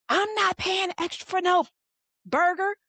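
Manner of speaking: high-pitched indignant refusal; irate customer. Retold or imitated. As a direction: imitate a customer yelling over a burger. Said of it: "the lady was screaming and yelling".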